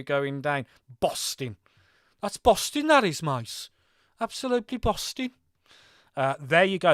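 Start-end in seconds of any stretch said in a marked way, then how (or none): 2.2-5.3 broad Brummy accent, high and loud in bursts. Shown as a demonstration. Streamer showing viewers the local slang, in a Brummy accent. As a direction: put on a punchy Brummy accent. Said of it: "That's the Brummy accent".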